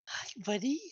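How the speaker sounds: high, sweet coddling voice; sweet kobold voice. Acted. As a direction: coo at the little one. GM voices kobold to his kid.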